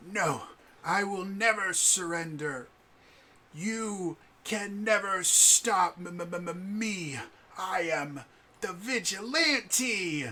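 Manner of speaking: put-on high, loud, melodramatic child's voice with drawn-out words; defiant play-acting kid. Downed kid to sword-wielding playmate, grandly defiant. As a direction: stretch it out like a wounded little hero defying a foe. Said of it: "And he's like".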